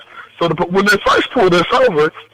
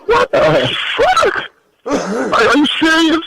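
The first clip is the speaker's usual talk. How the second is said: incredulous; 12 semitones higher, slower than usual. Retold or imitated. Retold disbelief at officer's threat.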